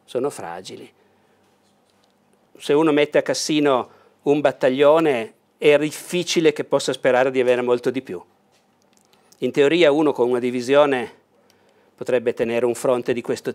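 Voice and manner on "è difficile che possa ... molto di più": ordinary talk, nothing marked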